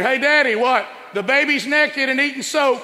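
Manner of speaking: put-on high, loud child's voice calling to dad; excited kid calling out. Child tattling to dad about the naked baby, high and loud. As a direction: shout it up to daddy like a tattling kid.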